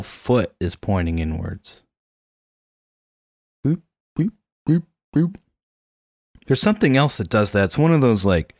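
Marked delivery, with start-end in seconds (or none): 3.7-5.3 high boop noises for a toy walking; high boop sound effect. Retold or imitated. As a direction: squeak a silly boop, boop, boop. Imitating toy's walking sound.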